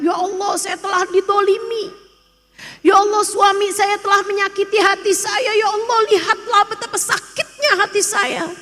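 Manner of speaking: lightly hammed-up self-pitying petition; mock complaining prayer. (doa mengeluh yang ditirukan, lebih tinggi dan cepat)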